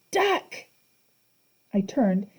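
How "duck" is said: yelled. A narrator's reading. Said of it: "Grover yelled".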